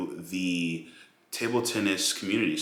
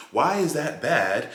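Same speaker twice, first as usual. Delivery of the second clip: higher, slow, drawn-out mock question; mock viewer question; 7 semitones higher, 4 dB louder, slower than usual. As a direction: stretch it out, mocking the viewers' defence. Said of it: "you're probably asking right now, like".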